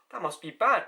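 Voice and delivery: fake-scandalised gasp; mock gasp. People shocked by nihilism, mock gasp. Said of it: "you go".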